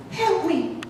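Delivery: tiny high falsetto, soft distant plea; pleading little rabbit. Acted. Captive rabbit calling from another room, high plaintive plea. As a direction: call for help in a small squeaky voice. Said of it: "hears a voice in another room".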